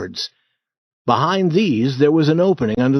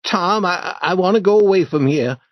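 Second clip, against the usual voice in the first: put-on scared boy, a touch quick and stammering; mildly frightened, wanting to leave; a hint of a tremble; shaking voice; 6 semitones higher than usual. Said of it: "Huck said in a shaking voice".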